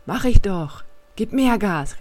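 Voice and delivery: lightly acted urgent call. A narrator's reading. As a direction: push the words out over the engine. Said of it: "rief ich".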